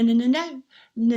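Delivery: ordinary delivery, nothing marked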